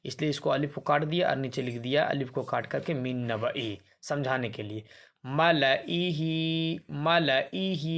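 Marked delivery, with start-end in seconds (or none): 5.3-7.9 very slow recitation of मलईही, alif silent; Quranic recitation. Shown as a demonstration. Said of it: "यहाँ पर भी अलिफ silent है".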